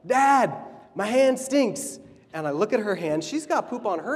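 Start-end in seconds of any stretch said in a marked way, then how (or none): 0.1-2.0 put-on high, slow little-kid voice; a four-year-old complaining to her dad; four-year-old daughter. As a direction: whine it like a small child. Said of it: "she's like".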